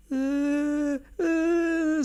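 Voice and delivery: high, held mock wail; long high-pitched "uh" of fake sobbing; imitated child's crying. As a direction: mimic a little girl bursting into tears. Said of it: "starts crying her eyes out".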